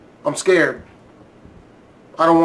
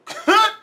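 high nervous giggle; a quick high nervous laugh. Real, not acted. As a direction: giggle off the nerves. Nervous laugh before scary challenge.

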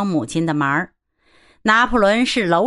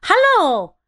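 called out hello; 10 semitones higher than usual. Acted. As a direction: sing out a greeting on coming in. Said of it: "一进门就叫".

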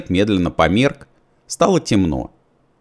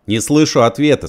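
sharp, raised angry demand; 7 semitones higher, 3 dB louder than usual. A narrator's reading. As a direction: demand an answer angrily. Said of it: "сказал я зло". (зло, с нажимом)

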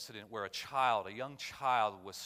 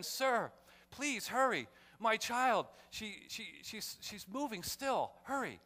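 higher, louder frantic plea to drive faster; desperate father pleading; 10 semitones higher, 4 dB louder, slower than usual. Retold or imitated. Father in back seat begging reporter to hurry, raised pleading voice. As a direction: beg him to hurry, voice raised and strained.

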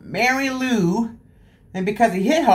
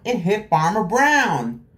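ordinary delivery

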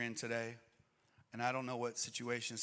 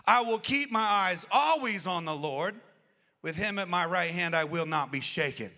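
louder, high psalm reading fading down; proclaimed psalm; 4 semitones higher, 9 dB louder than usual. Acted. Preacher reading a psalm aloud to the congregation, louder. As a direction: ring out the verse, then settle.

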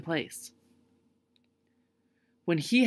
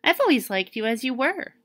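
put-on high young girl, simply said; Dorothy voiced much higher, plain and kind; a little girl speaking simply; 9 semitones higher, 5 dB louder than usual. A narrator's reading. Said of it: "said Dorothy, simply".

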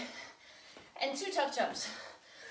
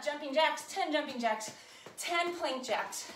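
ordinary delivery

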